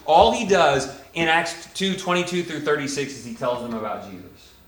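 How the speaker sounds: ordinary talk, nothing marked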